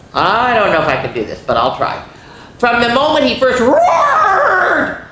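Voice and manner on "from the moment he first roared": big high shouted opening with a laugh; storybook roar. Acted. Reading to kids, roaring the opening line, high and loud. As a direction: roar the first line like a lion.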